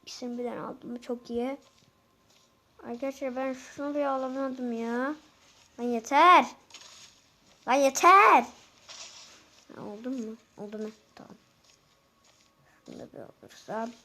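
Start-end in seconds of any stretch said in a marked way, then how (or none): 5.8-8.4 angry shout. Real, not acted. Frustrated gamer yelling at game.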